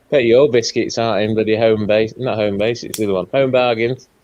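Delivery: ordinary talk, nothing marked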